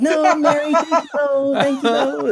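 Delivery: very high, comic nervous decline; hammed-up high-voiced refusal, half laughing; flustered polite refusal. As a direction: turn down the offer in a squeaky panic.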